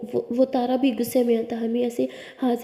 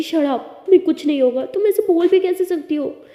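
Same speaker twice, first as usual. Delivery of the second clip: upset retort; 6 semitones higher, 6 dB louder than usual. Acted. Tara snaps at Siya's warning.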